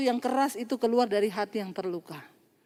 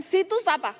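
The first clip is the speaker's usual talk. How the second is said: higher, curt mock challenge; imitated rude question; 7 semitones higher than usual. Preacher recalling the woman's earlier bitter question, curt imitation. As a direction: demand it disrespectfully, pitched up. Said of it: "Tadi kan".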